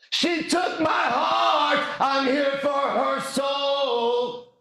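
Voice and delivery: high, very slow hammed-up cry; slow high dramatic shout. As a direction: belt it out like a tragic ballad. Dramatic quoted line.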